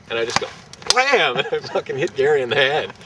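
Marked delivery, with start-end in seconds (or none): 0.9-1.4 sudden high, loud "blam"; explosive burst; loud impact exclamation. Retold or imitated. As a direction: punch it out like a comic-book hit. Acting out the hit.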